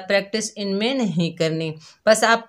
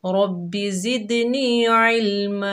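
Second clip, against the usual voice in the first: slow, melodic recited prayer; drawn-out Arabic recitation; tajweed recitation; 2 semitones higher, slower than usual. Shown as a demonstration. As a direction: chant the dua slowly. Recites dua three times.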